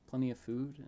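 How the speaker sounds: plain reading voice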